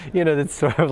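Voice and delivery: ordinary delivery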